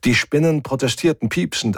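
ordinary delivery, nothing marked

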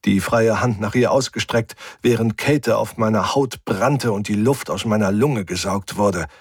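usual speaking voice

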